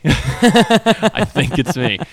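laughing as the realisation sinks in; drawn-out laughing admission. Retold or imitated. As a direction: stretch it out while cracking up.

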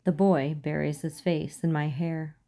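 usual speaking voice

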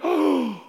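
imitated breathy gasp of amazement; awestruck gasp. Wife first seeing the monument, imitated awestruck gasp. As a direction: gasp as if stunned by the sight.

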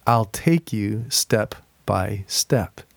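slow, measured read aloud; gentle slow reading. Shown as a demonstration. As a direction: take it step by step, slowly. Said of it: "going slow".

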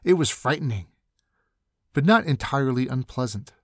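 ordinary delivery

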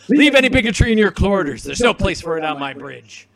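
loud acted captain's reprimand, easing at the end; hammed-up commanding dressing-down; stern rebuke. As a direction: lay down the law to a subordinate. Kirk acted rebuking the bigoted crewman.

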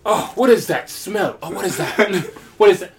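slow, disgusted chef sniffing; disgusted by smell. Retold or imitated. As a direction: recoil at the smell, drawn out. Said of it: "general one would probably be like".

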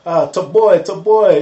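loud excited greeting, voice up; big hype like a bouncer greeting a friend; hyped greeting. Retold or imitated. Imitating hyped club guys greeting.

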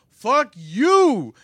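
loud, high retold outburst; mock furious cursing. Old lady cursing the manager, loud mock fury.

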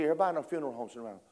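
ordinary talk

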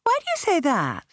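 high surprised exclamation; taken aback. Acted. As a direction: caught off guard, voice jumps up. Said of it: "Patsy exclaimed".

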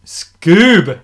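a dry loud announcement; loud deadpan title. Acted. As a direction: announce it loudly, with mock gravity.

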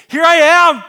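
huge, high-pitched eager yell; exclamatory shout. Acted. Isaiah answering the Trinity's call, shouting eagerly. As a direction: cry it out at full volume, high. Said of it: "exclamation mark".

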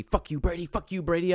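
high, loud mock yell at the TV; retold screaming. Fan yelling at Brady on TV, retold taunting shout. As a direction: taunt the rival quarterback like a madman. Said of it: "started just fucking screaming".

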